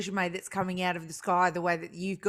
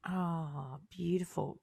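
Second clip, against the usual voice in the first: soft whisper; 3 semitones lower, 8 dB quieter, much slower than usual. Real, not acted. Admiring reply.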